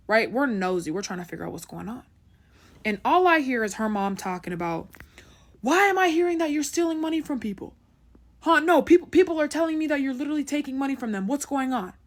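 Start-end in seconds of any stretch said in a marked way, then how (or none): 5.6-11.9 mom scolding. Retold or imitated. Mom yelling at daughter.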